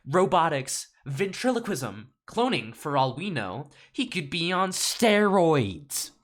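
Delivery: theatrical accusations, climbing to a drawn-out shout; outraged lawyer's rant. Acted. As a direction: build from scepticism to loud, high alarm.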